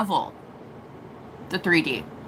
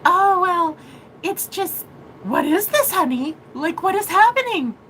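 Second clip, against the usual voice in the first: higher, sweet, bewildered mother; 7 semitones higher than usual. Retold or imitated. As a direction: imitate a gentle mom puzzled by her daughter. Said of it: "my mom is like".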